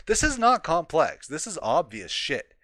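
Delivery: high, loud first burst, then easing; exasperated. Real, not acted. Ranting at the article's author over something obvious, exasperated. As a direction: hammer it out in real exasperation.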